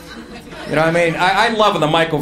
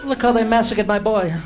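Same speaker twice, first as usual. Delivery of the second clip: Godfather impression; 4 semitones higher, 4 dB quieter than usual.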